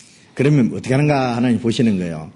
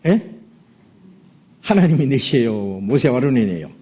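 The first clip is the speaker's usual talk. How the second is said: ordinary talk, nothing marked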